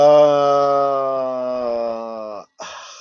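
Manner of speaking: one long, falling groan of thought; long drawn-out sigh. Real, not acted. Host choosing a former Arsenal figure, long falling sigh. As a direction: draw out a weighing-it-up noise. Thinking over whom to choose.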